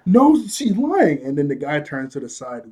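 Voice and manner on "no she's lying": high, loud, drawn-out denial; mock protest. Accused sandbender denying Toph's claim, high mock protest.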